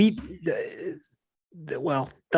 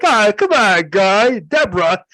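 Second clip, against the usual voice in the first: higher, louder Ray Romano whine; Ray Romano calling Deborah, louder; Ray Romano impression; 3 semitones higher, 6 dB louder, slower than usual. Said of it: "he's like".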